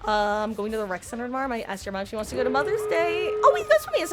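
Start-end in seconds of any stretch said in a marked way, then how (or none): 3.3-4.1 sudden realization. Real, not acted. Sudden realization mid-chat.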